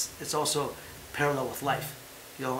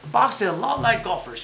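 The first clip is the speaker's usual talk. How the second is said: louder, higher, scoffing repeat of the claim; incredulous mock repeat; 6 semitones higher, 6 dB louder than usual. Host scoffing at pundits comparing boxing to golf, mocking disbelief. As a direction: throw the pundits' line back in disbelief.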